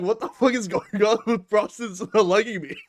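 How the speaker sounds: imitating Steve's frustrated outburst; exasperated Steve. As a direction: complain like a baffled friend.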